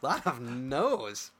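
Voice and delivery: laughing through the words slowly. Real, not acted. Co-host laughing at the pile of wrong answers, slow and amused. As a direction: chuckle it out, drawn right out.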